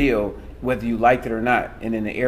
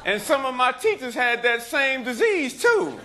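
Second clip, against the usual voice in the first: higher-pitched, projected stage storytelling; stand-up performance; 11 semitones higher than usual. Acted. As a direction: work the crowd with a mock confession.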